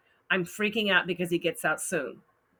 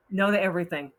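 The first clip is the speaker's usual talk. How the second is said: usual speaking voice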